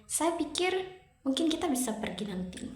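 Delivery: "Saya pikir" lifted high and left hanging before "mungkin kita bisa pergi nanti"; fall-rise intonation. Shown as a demonstration. Teacher showing doubt, fall-rise intonation on "Saya pikir mungkin". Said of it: "intonasi turun naik".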